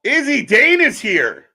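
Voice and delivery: high, loud and slow announcement; excited announcement. Real, not acted. Announcing the surprise entrance to chat, loud excited call. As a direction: hype the surprise arrival.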